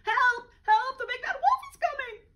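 very high, loud panicked cry; panicked squeal. Acted. Little pig fleeing to his brother, panicked squeal. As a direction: squeal for help while running.

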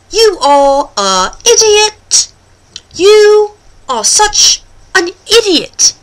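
drawn-out, separated words leading to "idiot"; British demo. Shown as a demonstration. Host puts idiot in an example, slow British demo. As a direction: teach "idiot" slowly in mock British. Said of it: "teach you how to say".

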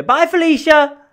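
imitated high, loud sign-off holler; Joy. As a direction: call it out high and drawn out, mimicking a co-host. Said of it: "she'll go".

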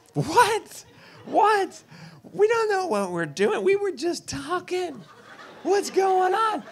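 startled relatives, high and frantic; panicked family. Retold or imitated. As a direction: shriek in hammed-up alarm. Family panics at ordered chicks.

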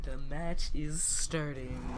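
low, slow announcer imitation. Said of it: "echoing the game announcer".

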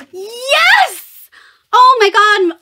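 high, loud squeal of joy; excited shout. Real, not acted. Thrilled at a new book sleeve, squealing yes. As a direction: burst out with delight. Opening a favorite box item.

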